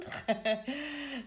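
short high chuckle; amused little laugh. Real, not acted. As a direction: chuckle to yourself. Joking about assuming the position.

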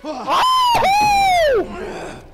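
loud high shriek; fright scream. Real, not acted. Streamer shrieking at a jump scare while looting.

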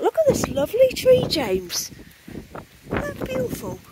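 high, fast, loud swooning over a tree; wind-blown rapture over a lovely tree; gushing delight. Real, not acted. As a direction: sell the beauty of the tree to James. Admiring blossom in the wind.

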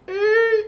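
high thin "eee" sound effect; drawn-out high squeal; imitated plane whine. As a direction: mimic a plane losing height. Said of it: "And the plane's like".

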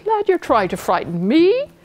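high, scoffing question; snorting disbelief. A narrator's reading. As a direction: scoff at being frightened. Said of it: "snorted the captain".

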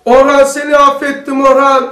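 loud, high, slow cry of forgiveness; shouting forgiveness. Shown as a demonstration. Preacher shows shouting forgiveness alone in a room, loud and slow. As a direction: call it out loud and drawn out, three times. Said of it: "Bağırarak".

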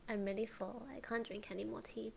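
ordinary talk

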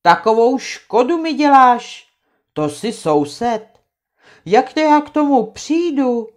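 angry complaint. Acted. Trautenberg rages at Krakonoš about the damage, angry. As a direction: snap back at him.

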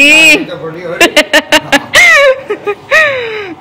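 high, amused laugh falling away; bright laugh trailing down. Real, not acted. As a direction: laugh at the uncle's swagger. Laughs at uncle's swagger.

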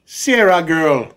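slow, hammed-up Jamaican accent, sounds Irish; mock Jamaican accent like a leprechaun. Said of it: "can I try that again?".

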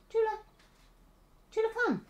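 very high coaxing call; calling a cat. Real, not acted. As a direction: sing out to the kitten, up high.

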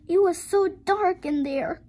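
put-on high-pitched child's exclamation; high storybook girl voice; little girl crying out. A narrator's reading. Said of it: "cried the little girl".

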